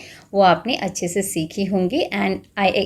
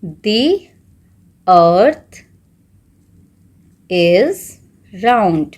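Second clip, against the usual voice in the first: each word spaced out with long pauses; slow dictation of the corrected sentence; 3 dB louder, much slower than usual. Shown as a demonstration. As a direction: dictate word by word for students to write. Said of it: "तो आप क्या लिखेंगे".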